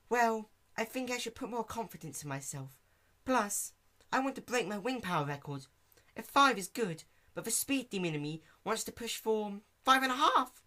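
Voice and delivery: Fluttershy sharing her goal with Dash. Acted. Fluttershy speaks optimistically.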